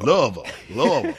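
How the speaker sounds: Louisville slurred into one long syllable, LUH-vul; local pronunciation. Retold or imitated. Imitating locals for the hosts, Louisville run together as LUH-vul. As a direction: squash Louisville down to a long "luvull". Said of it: "That's how they say it".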